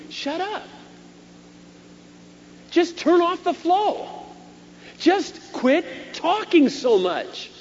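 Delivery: high, slow, loud preacher's cry; impassioned sermon climax; preaching at full force. Acted. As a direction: lift it to the rafters. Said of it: "preaching climax".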